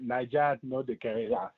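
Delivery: Nigerian Pidgin, "Niger" said as "naija"; Nigerian accent. Shown as a demonstration. Host's guest shows him the proper Nigerian pidgin, Nigerian accent. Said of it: "it's the way you're saying Niger".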